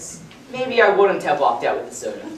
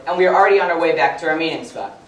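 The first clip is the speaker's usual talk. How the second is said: usual speaking voice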